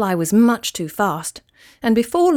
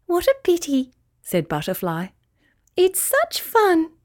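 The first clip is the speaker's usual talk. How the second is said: tinkly butterfly voice; 8 semitones higher than usual. A narrator's reading. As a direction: float it up playfully.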